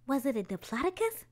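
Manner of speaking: plain reading voice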